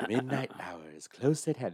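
hushed, theatrical menace; Vincent Price. Acted. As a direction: intone it like a spooky movie host. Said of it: "a good Vincent Price impression".

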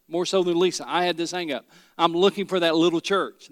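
normal talking voice

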